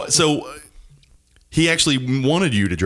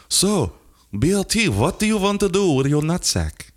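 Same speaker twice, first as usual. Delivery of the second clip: put-on high Indian doctor accent; 8 semitones higher than usual. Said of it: "was the doctor Indian?".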